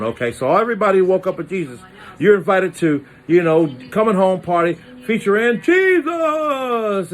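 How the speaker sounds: high, hammed-up party announcement; mock announcer. Announcer inviting everyone to the Jesus party, high hype. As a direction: sell the homecoming like a hype man.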